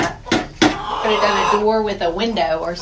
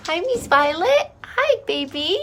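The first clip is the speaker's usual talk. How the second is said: cooing to hens; 9 semitones higher than usual. Real, not acted.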